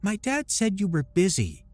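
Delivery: put-on earnest little girl, high; small child reporting seriously. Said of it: "brow furrowed in concentration".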